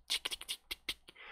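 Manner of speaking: breathy tapping sound; whispered tick tick. Retold or imitated. Imitating tapping on plastic.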